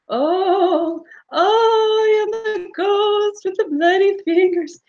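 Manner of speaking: high, sing-song ghostly moan. Acted. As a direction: croon it like a spooky ghost. Ghost announces itself to guests.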